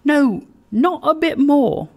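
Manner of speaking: high, loud playful protest; indignant daughter. A narrator's reading. Bride answering her suitor's teasing, playful protest. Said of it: "said Meg".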